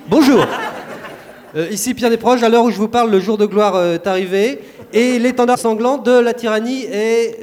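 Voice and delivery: excited reporter live from the front; war correspondent. Acted. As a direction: report breathlessly from the battlefield. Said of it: "Reporter de guerre".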